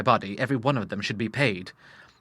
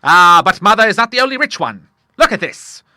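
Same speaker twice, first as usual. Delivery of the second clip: loud, high, exultant Admiral; triumphant reveal; 9 semitones higher, 12 dB louder than usual. A narrator's reading. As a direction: boast gleefully as you show it off. Admiral triumphantly reveals his cheque.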